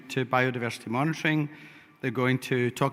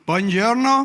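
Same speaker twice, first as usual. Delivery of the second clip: hammed-up Scottish accent, higher and louder; mock Scottish accent; 10 semitones higher, 9 dB louder, slower than usual. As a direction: call it out in a joking fake Scottish accent. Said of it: "As said in my best Scottish accent".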